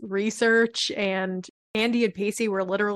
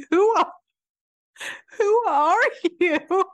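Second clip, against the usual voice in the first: imitated upset; 9 semitones higher than usual. Quoting Abby rebuffing her partner at lunch, mock upset. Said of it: "She's so upset".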